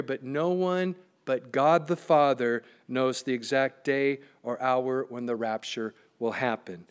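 ordinary talk, nothing marked

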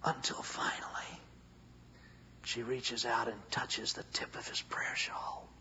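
whispered storytelling at the climax; hushed narration. A narrator's reading. The preacher telling the congregation of the touch in a whisper.

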